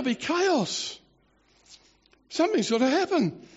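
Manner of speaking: high-pitched, spluttering alarm, hammed-up; mock freaking out. Adviser warns king that women will rise up, mock frantic. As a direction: play a panicking adviser warning of catastrophe. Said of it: "He's freaking right out, this guy".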